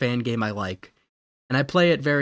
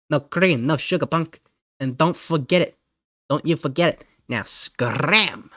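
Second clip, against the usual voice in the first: lightly voiced mobster-like scolder; scolding tough guy. Tough guy warning a troublemaker not to come back, scolding. Same scolding character continues.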